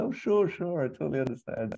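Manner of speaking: high, overly agreeable; Murray impression. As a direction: chirp the polite agreement. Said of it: "Murray's like".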